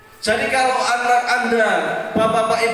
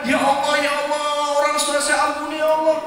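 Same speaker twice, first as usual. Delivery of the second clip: fast, earnest pleading to God; pleading child's prayer; 4 semitones higher, much faster than usual. Acted. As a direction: beg God for your parents' forgiveness. Child prays again. (doa anak yang memohon dengan sungguh-sungguh)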